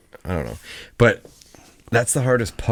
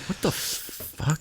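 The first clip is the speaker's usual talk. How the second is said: whispered disbelief; 9 semitones higher, 10 dB quieter, much slower than usual. Pretend. Parent's stunned reaction to kid.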